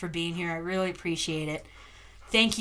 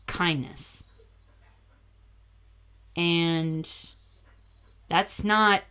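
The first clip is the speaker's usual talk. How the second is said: ordinary talk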